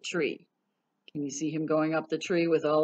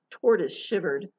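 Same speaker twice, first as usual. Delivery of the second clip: plain reading voice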